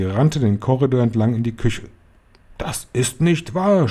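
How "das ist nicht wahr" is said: high, slow protest at normal volume; mild narrated cry. A narrator's reading. Hotel manager denying rats to grandmother, restrained cry. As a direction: cry out a drawn-out denial, without full shouting. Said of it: "schrie Mister Stringer".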